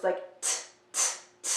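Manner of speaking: "t" as quick unvoiced bursts; short popped "t, t". Shown as a demonstration. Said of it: "a little short burst".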